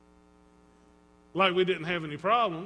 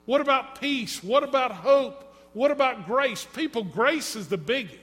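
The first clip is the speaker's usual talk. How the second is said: louder, higher ringing preacher's questions; preaching at full force; 5 semitones higher, 6 dB louder than usual. Acted. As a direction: build the congregation up with each question. Rising preaching climax.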